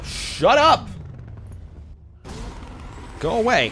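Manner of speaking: high-pitched, annoyed shoo; irritated yelp to be left alone; exasperated outburst. Real, not acted. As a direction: tell a pest to shut up, voice jumping up. Snaps at an interruption mid-recording.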